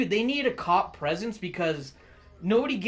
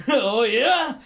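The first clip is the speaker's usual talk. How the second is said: higher, louder hammed-up dare; mock tough threat; 3 semitones higher, 4 dB louder than usual. Flagger with spiked flail daring a speeder, loud mock threat.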